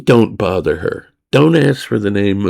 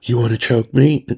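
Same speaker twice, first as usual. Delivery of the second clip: ordinary delivery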